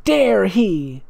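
slow, outraged "how dare he"; mock-furious, stretched out; indignant. Reading the narrator's inner outrage in caps. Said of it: "she didn't say that out loud".

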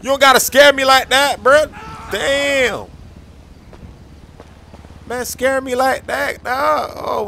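high, loud jumpy yelps after a scare; real shaken shout, then a slow relieved groan; startled fright. Real, not acted. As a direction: react as if a figure just lunged at you. Startled by a moving prop.

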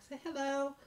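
usual speaking voice

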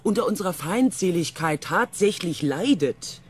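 loud, high, agitated interruption; exasperated impatience. Acted. Crew member waving off the hate talk, exasperated. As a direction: cut in, fed up with the bickering.

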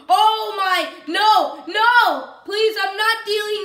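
loud, high cries of protest; alarmed outcry. Real, not acted. Gamer facing zombies again, loud alarmed outcry.

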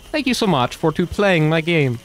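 Mario impression. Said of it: "Mario would look at the camera and go".

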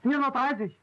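range estimate called out high; calling out. Acted. Trainee guessing the distance to the house, calling out. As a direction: call your answer up to the instructor.

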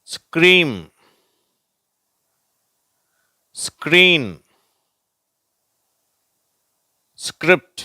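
ஸ்க்ரீம், ஸ்க்ரீன், ஸ்கிரிப்ட் said high and loud with pauses; teacher reading out scr words; model words scream, screen, script. Shown as a demonstration. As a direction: say each scr word slowly with a pause after it. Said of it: "வார்த்தைகளை பார்ப்போம்".

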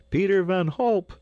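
very high, loud cheer of a name; crowd's shout. A narrator's reading. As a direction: roar the winner's name like a crowd. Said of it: "shouted a hundred voices".

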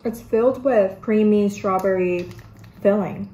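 speaking around a bite; chewing while talking. Real, not acted. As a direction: mouth partly full of wafer. Seen 1: Bites wafer at -3; lips tight, chewing-like mouth until about +3.

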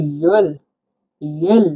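ইয়েল with a y glide into el; ইয়েল said as yel; ye-el sound. Shown as a demonstration. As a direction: blend ই and এল into ইয়েল. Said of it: "এটা হবে ই, এটা হবে এল".

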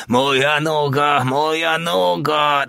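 high, slow, drawn-out wail; despairing moan. A narrator's reading. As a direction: wail in pain, dragging each word. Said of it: "dyszał zrozpaczony". (rozpaczliwe, przeciągłe zawodzenie)

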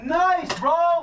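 loud high hype; excited cheer. Real, not acted. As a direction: cheer a teammate's kill. Cheering a good shot.

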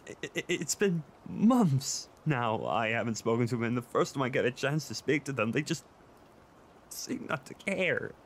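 upset, breaking off mid-thought; tearful distress. Acted. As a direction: plead through tears, halting between phrases. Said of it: "crying like a little baby right now".

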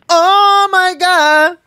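high, loud squeaky exclamation; funny high voice. Shown as a demonstration. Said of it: "А ещё раз можешь?". (писклявое «о май гад»)

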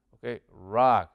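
normal talking voice

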